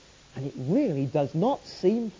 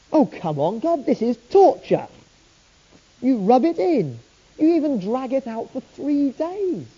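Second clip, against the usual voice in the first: angry at God; 7 semitones higher than usual. Retold or imitated. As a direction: complain to God, hurt and indignant. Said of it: "feeling angry".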